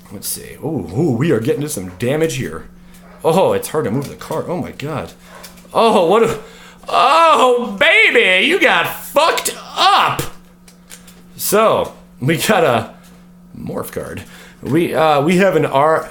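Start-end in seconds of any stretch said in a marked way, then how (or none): 5.7-10.2 higher and louder, gleeful, slowing at the end; excited. Real, not acted. YouTuber thrilled by a card pull, gleeful exclaiming. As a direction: savour the find with glee.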